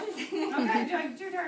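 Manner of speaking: laughing through the words. Real, not acted. Teacher laughing mid-activity.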